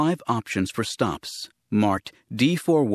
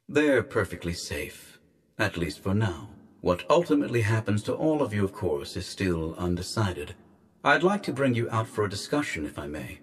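softer, measured official over a speaker; disembodied guardian voice; 4 dB quieter than usual. Acted. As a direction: apologise quietly, with cool courtesy.

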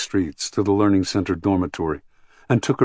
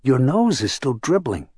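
lightly voiced child, pitched higher; keen, matter-of-fact child; 6 semitones higher than usual. A narrator's reading. Small girl remarking on a visitor's dribbling nose, keen child voice. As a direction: point it out keenly, without judgement. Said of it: "keenly, but without emotional bias".

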